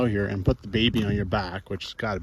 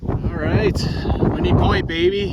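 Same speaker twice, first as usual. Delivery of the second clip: excited; 6 semitones higher, 7 dB louder than usual. Real, not acted. Reaching the viewpoint.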